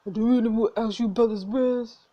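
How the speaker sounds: a hint of a tough bar patron's voice; character voice. GM restarts the line in a lightly voiced character. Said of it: "I need to make a voice for you".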